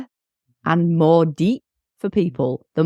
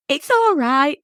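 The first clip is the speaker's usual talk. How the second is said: put-on child reassuring; 8 semitones higher, slower than usual. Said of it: "usually they go".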